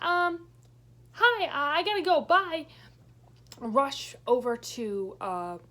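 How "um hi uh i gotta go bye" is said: flustered escape. Retold or imitated. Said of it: "I'm like".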